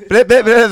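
mock stutter. Mocking the stutter loudly.

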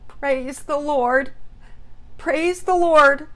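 high, slow, loud rejoicing; thick with emotion. Acted. Mother over the receiver hearing daughter is found, emotional cry. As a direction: cry it out, drawing out each word. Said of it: "thick with emotion".

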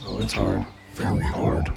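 sexy deep voice. Pretend. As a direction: drop it deep and seductive. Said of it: "some more of that sexy deep voice".

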